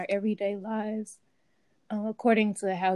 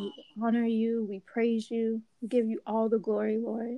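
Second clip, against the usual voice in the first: prayer. Real, not acted. Prayer continues.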